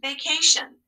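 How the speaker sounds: teacher models blending; blended whole word. Shown as a demonstration. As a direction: blend "Vacation" all together. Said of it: "Read it all together".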